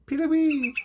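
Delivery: high ringing peelawee call; mock willet call; bird call imitation. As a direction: squeal it out like a shorebird.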